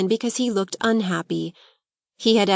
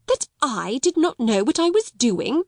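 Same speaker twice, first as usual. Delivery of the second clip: higher, incredulous echo rising at the end; gasping; 5 semitones higher than usual. Acted. Headmistress echoing her pupil, gasping in disbelief. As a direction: caught off guard, repeating in disbelief. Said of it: "Miss Minchin fairly gasped".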